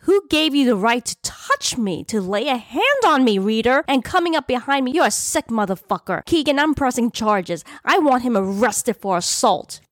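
high-pitched, heated accusation; enraged tirade. A narrator's reading. Woman to man who came up behind her, enraged tirade. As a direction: rail at him, pitch up throughout. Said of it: "I screamed with rage".

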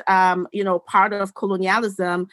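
ordinary delivery

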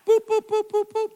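high beeping like a checkout scanner; chirpy mock scanner beeps. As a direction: mimic the register going boop. Imitating the register scanner again.

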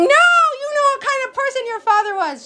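shrill high scream at her husband; screaming wife. Retold or imitated. Wife screaming at husband about his father, high shriek. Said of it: "the wife starts screaming".

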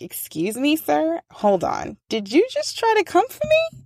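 imitating a student daring the teacher; sassy challenge. Student mockingly challenges teacher in class, sassy high voice.

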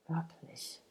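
barely voiced, hushed question; whispered doubt. A narrator's reading. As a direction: breathe the word out softly. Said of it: "flüsterte Joachim".

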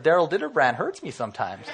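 laughing while quoting his son; real laugh breaking through the words. Real, not acted. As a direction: chuckle as you tell it.

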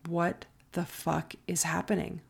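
hushed retold bewilderment; stunned inner thought. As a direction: say it under your breath, as if thinking. Inner thought in the cop car.

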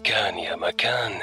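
soft whispered storybook opening. Acted. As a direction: whisper the old tale's opening.

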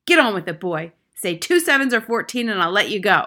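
loud, rushed threats; impatient, hectoring headmistress; Trunchbull bellow. A narrator's reading. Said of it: "bellowed the Trunchbull".

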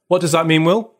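usual speaking voice

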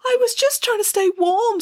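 put-on high, plaintive child's voice; pleading little girl. A narrator's reading. Cold girl pleads her excuse.

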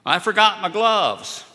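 put-on loud, high, cheerful old-timer call; piping up. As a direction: blurt it out gleefully in a high voice. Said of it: "piped up".

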